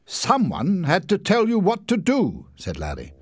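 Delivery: high, condescending explanation; superior. A narrator's reading. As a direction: lecture them on brains. Larry defending himself.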